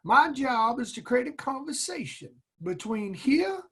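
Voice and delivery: a retold drill instructor's Southern accent, heightened; deep Southern accent. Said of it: "in his deep Southern accent".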